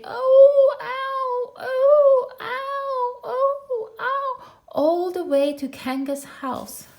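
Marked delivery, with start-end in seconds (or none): 0.0-4.3 very high yelps in rhythm with each jump; bouncing oh-ow cries. Acted. Piglet carried bouncing home, high oh-ow squeals. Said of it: "he was saying".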